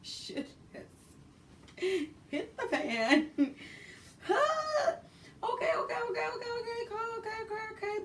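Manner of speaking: very high, fast panicked okays; high frantic repeated okay; mock panic. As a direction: spiral into comic panic. Panic when door locks.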